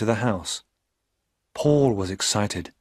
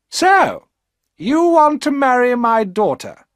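put-on high, loud, harsh rich old woman; hard old woman; 14 semitones higher, 8 dB louder than usual. A narrator's reading. Rich old mother mocking her daughter's suitor, high and loud. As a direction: sneer down at the poor suitor. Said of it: "Her voice was hard".

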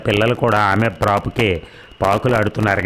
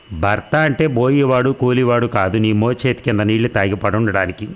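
plain reading voice

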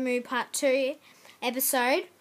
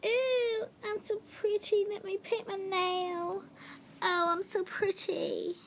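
higher, preening and pleased with herself; vain pretty voice; 6 semitones higher, 5 dB quieter than usual. Pretend. Kid playing with nail polish, pretending, high preening voice. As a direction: admire yourself like a fancy lady.

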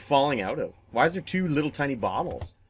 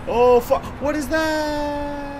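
high startled cry, stretched out; alarmed; 12 semitones higher, much slower than usual. Real, not acted. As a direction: cry out high and drag the last word. Startled by something in the game.

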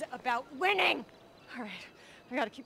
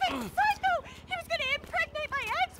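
screamed much higher; furious scream; 13 semitones higher than usual. Acted. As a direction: shriek the insult at him. Woman screaming at her suitor.

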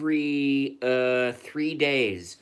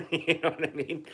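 higher-pitched, said through a laugh; 4 semitones higher, 5 dB quieter than usual. Real, not acted. Streamer amused by own diet joke, laughing through the words. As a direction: let real laughter carry the words.

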